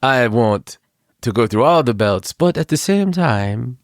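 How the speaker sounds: strange put-on accent, halting between phrases; mock foreign accent with deliberate pauses; [name]'s strange accent. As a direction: state your plans in an odd mock accent. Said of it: "why I talk with this accent".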